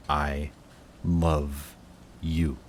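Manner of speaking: each word set apart by a pause; halting confession. Acted. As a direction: give every word its own beat.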